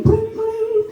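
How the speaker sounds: high-pitched mimicked phone ring; old telephone ringing. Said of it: "this old telephone ... going".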